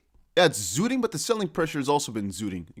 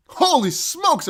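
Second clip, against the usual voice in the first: drawn out, higher, slow astonished cry; amazed exclamation; 9 semitones higher, 4 dB louder, much slower than usual. Real, not acted. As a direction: gasp it out at the chart. Price jumps suddenly.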